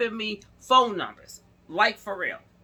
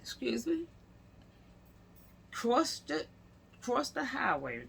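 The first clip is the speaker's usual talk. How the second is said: whispered, softer, in disbelief; stunned whisper; 3 semitones higher, 8 dB quieter than usual. Real, not acted. Reacting to the officer being at a picnic, stunned whisper. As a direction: breathe it out in shock. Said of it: "stunned whisper reaction".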